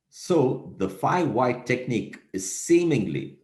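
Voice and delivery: seemingly stretched out slowly and lifted; stress on seemingly. Shown as a demonstration. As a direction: lean hard on seemingly and slow down on it. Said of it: "emphasis on the word seemingly".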